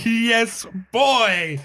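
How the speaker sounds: high, thrilled exclamation; excited cheer. Real, not acted. Co-host cheering the cricket bat pick, excited. Reacting to a favourite film pick.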